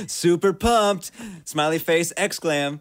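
high, slow gushing brag; hammed-up excitement. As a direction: gush it like an overexcited poster. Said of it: "That's the worst Facebook status".

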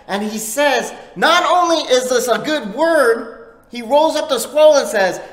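full-force preaching. Acted. Preacher proclaiming to the church, loud and high. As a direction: proclaim it to the whole congregation.

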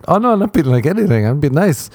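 quick, high-pitched protest of innocence; defensive protest. Retold or imitated. Telling an upset party guest he meant it nicely, defensive protest.

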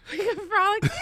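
high, laughing repeat. Real, not acted. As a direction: laugh while echoing it. Repeating word while laughing.

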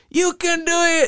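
high yelled catchphrase; crowd yelling catchphrase. Retold or imitated. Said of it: "yelling out".

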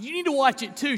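imitating his own sobbing. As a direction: replay the choked-up husband, lightly. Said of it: "I was just sobbing".

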